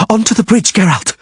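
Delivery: high urgent yell. A narrator's reading. Sorceress yelling at Geralt to reach the bridge, high yell. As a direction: yell it over the rockfall. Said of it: "the sorceress yelled".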